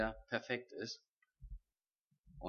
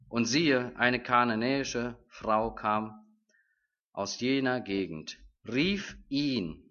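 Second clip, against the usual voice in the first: louder, solemn Bible reading; scripture reading; 5 dB louder than usual. Acted. Preacher reads Matthew fifteen to the congregation, solemn and louder. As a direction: read the passage out to the congregation with weight.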